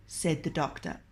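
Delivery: plain reading voice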